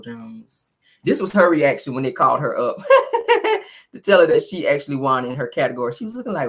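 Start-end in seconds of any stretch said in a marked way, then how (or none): 2.8-3.7 high laugh. Real, not acted. Laughing at the reaction clip.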